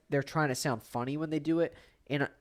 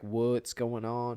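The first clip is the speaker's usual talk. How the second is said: slow, low, overdone "What's going on?" with a Southern lilt; exaggerated imitation; 3 semitones lower, 3 dB quieter, much slower than usual. Teasing a friend's pronunciation, slow exaggerated accent. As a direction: mimic his accent, overdoing it. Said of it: "that was over-exaggerated".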